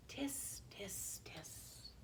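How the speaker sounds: tiny unvoiced monkey hisses; whispered chatter. A narrator's reading. Monkeys answering the peddler, whispering. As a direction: whisper each sound separately, very softly.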